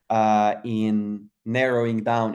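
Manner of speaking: ordinary talk, nothing marked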